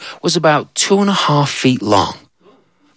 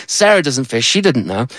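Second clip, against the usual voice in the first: ordinary talk, nothing marked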